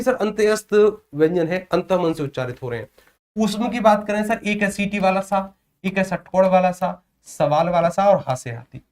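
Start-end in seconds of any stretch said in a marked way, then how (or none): none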